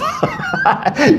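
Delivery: a sudden high laugh, real amusement; high burst of laughter. Real, not acted. Laughing at the rich man's guilty reaction, high real laugh.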